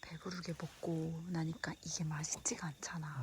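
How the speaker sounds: soft unvoiced whisper. Real, not acted. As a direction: hush it so nobody wakes. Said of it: "목소리 크게 안 내서 돼서 좋다".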